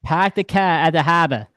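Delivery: badly faked New England accent, r's dropped; bad Boston accent. Host repeats the catchphrase for a pained New Englander, bad Boston accent. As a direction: put on a clumsy Boston accent. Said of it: "Please don't ever do that again".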